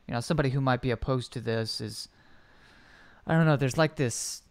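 usual speaking voice

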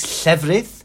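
llefrith opening on the hissed Welsh LL; North Welsh word. Shown as a demonstration. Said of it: "If you're from North Wales".

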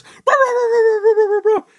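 high, yappy "bah bah" barking; hammed-up dog barking; mock dog bark. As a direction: yap like a little dog. Dog barking in retold game scene.

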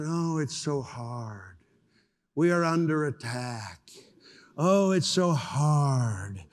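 slow, drawn-out mock complaint; mock whining. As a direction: imitate a whiner, dragging out the words. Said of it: "They whine".

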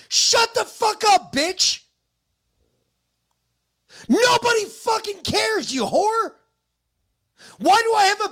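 loud, cursing outburst; furious shout. Real, not acted. Husband cursing out the neighbor who complained, furious shout. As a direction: yell it straight at the neighbor in real fury.

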